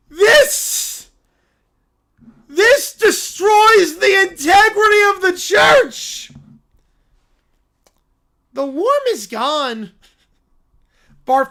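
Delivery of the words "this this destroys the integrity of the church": loud, anguished preacher's cry; a wounded zealot shouting at the sky; outraged preacher. Acted. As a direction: howl it like your church just fell. Character outburst in game.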